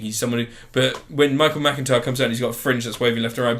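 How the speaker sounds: ordinary delivery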